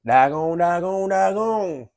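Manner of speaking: loud, rising country cartoon impression; Mater. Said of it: "In the great words of Mater".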